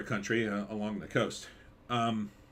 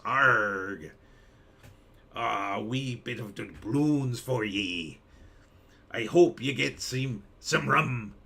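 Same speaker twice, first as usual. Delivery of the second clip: pirate voice; slower than usual. Pretend. Streamer teasing a viewer about pirate gold in a pirate accent. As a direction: drag out the doubloons like a stage pirate. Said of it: "I like to talk in a pirate voice".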